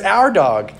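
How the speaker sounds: high, loud corrective interjection; bickering correction. Acted. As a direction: cut in to insist on "dog" being shared. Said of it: "they're bickering with each other".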